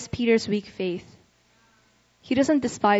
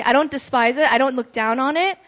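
ordinary delivery

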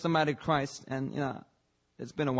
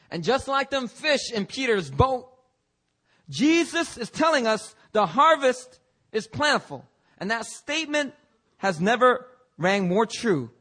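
high, loud, slow preacher's cry; building to a fervent preaching peak; preaching at full force; 9 semitones higher, 7 dB louder than usual. Acted. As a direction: lift it like a revival sermon.

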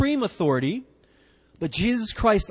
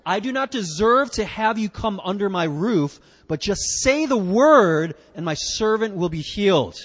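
reading scripture together; 3 semitones higher, 4 dB louder than usual. Shown as a demonstration. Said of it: "let's read verse eight together".

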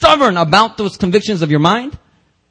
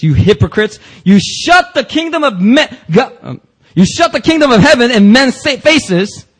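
harsh rebuke; 8 semitones higher, 5 dB louder than usual. Acted. Jesus condemning the religious leaders, high and loud. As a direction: denounce them at full force. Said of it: "Jesus used the harshest tone".

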